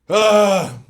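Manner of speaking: sharp loud cry; pained shout. Real, not acted. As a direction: yelp from the burn. Burning in throat.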